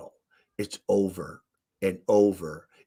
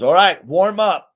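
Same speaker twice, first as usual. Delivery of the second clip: louder, higher mock coach call; coach's call; 7 semitones higher, 9 dB louder than usual. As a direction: bark it out like a coach to his team. Coach blowing whistle, calling team.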